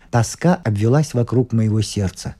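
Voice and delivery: ordinary delivery, nothing marked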